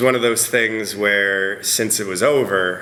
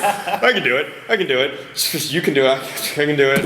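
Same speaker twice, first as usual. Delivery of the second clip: psyching self up; 7 semitones higher, 3 dB louder than usual. Retold or imitated. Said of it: "so you're like".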